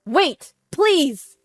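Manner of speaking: loud, high plea; desperate cry. A narrator's reading. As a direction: cry out before she hangs up. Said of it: "cried out in desperation".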